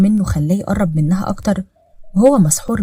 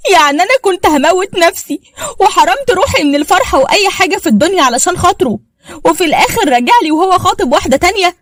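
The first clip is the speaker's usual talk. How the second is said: much higher and louder, near shrieking; the wife screams after learning he is engaged, hysterical; hysterical outburst; 11 semitones higher, 3 dB louder than usual. Acted. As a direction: break down screaming in betrayal. Throws things, hysterical outburst.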